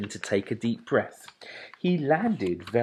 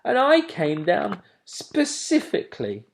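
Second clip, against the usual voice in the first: cross owlet; 11 semitones higher, 6 dB louder than usual. A narrator's reading. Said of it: "he said crossly".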